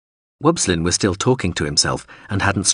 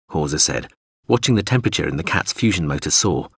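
ordinary delivery, nothing marked

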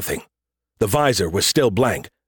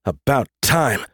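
slow, gruff grunt; slower than usual. A narrator's reading. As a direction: grunt it out, unimpressed. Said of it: "Adams grunted".

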